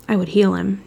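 ordinary delivery

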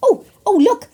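plain reading voice

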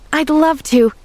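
high, loud, eager exclamation; exclaiming. A narrator's reading. Girl accepts the doctor's invitation, exclaiming eagerly. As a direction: accept with instant delight. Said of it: "exclaimed Pollyanna".

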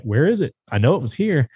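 imitating a lost, questioning dog; puzzled dog's voice. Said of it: "looking back at me like".

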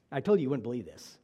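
normal talking voice

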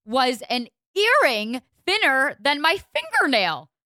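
so worked up the voice breaks; voice cracking. Real, not acted. Venting about an actor's earring, voice cracking. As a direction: voice cracks up high in outrage. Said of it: "That literally made your voice crack".

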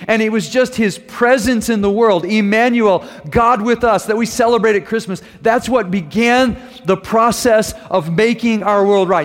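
high, loud, slow preaching build; fervent preaching. Acted. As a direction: proclaim it from the pulpit. Sermon opening, elevated preaching.

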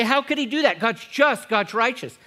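high, loud mock objection; imitating protesting listener. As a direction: voice the doubter's protest. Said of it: "You say".

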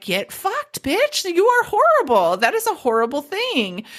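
high, indignant retort; mock outrage. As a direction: play the offended listener. Imagined listener's outraged reply.

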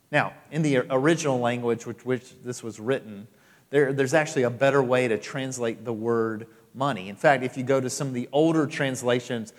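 normal talking voice

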